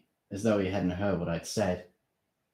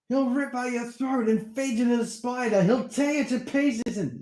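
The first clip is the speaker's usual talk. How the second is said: much higher, louder panicked rant; angry; 7 dB louder than usual. Acted. Steve warning Darren the vampire will come, angry. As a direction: frighten him with the danger.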